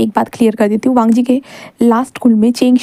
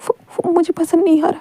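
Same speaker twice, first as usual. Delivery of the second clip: tearful confession; 5 semitones higher, 4 dB quieter than usual. A narrator's reading. Crying boy confesses jealousy.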